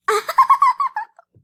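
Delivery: high shrieking laugh; burst of laughter. Real, not acted. Vlogger cracking up at a funny clip, a high shrieking laugh.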